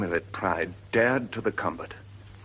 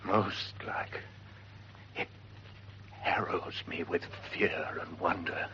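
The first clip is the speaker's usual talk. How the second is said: whispered in fear and wonder; whisper, shaken by the ghost; fearful whisper; 3 semitones lower, 9 dB quieter than usual. Acted. Horatio whispering his fear at the ghost.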